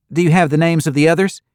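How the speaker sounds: put-on cheerful young desk clerk greeting guests. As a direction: chirp it out like a perky receptionist.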